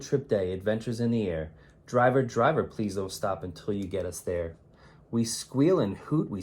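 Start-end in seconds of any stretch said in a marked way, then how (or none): none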